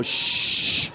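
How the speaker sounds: loud long "shh"; acted urgent shushing; emphatic shush. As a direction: hush someone hard, loudly. Hushing a kid who recognised him.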